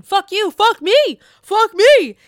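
high, loud, slow imitation of a cast member yelling; mock Candace outburst. Host mimics Candace's outburst to listeners, high hammed-up yell. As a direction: ham up her meltdown, shrieking it out. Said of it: "show Candace saying".

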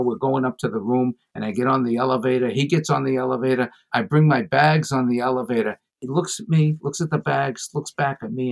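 normal talking voice

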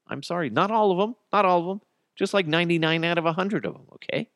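high, fast, backpedaling apology; mock apology. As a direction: hurry through a fake retraction.

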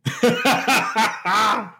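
high, sustained burst of laughter; amused laughter. Real, not acted. Laughs at Peterman being mentioned.

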